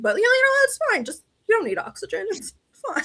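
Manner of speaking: very high, airy brush-off; mock nonchalance. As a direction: feign being totally okay, high-pitched. Mocking the team's dismissal of pain.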